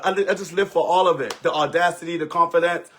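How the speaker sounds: ordinary talk, nothing marked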